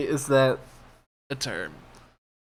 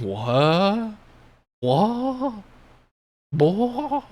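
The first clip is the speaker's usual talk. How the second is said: much higher, louder incredulous what; mock disbelief; 10 semitones higher, 3 dB louder, much slower than usual. Reviewer mocking Galadriel's stab advice, high incredulous. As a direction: act stunned at something obvious.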